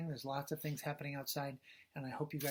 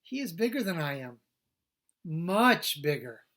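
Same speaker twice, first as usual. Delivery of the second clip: big letters read big; 2 semitones higher, 9 dB louder than usual. Acted. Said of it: "The word big is written big".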